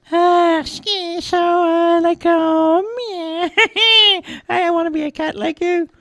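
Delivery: very high, squeaky hesitant chatter; curious young mouse. A narrator's reading. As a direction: starstruck little mouse quizzing a cat.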